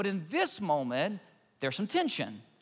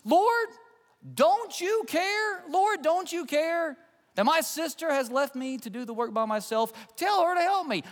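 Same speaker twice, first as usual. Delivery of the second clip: a frustrated sister snapping, much higher; snapping at Jesus; 9 semitones higher than usual. Retold or imitated. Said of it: "she snaps at Jesus".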